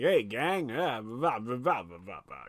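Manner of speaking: loose impression, sliding down and fading out; lightly put-on star voice, trailing into blah-blah; James Coburn. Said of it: "just James Coburn dubbing over Tom".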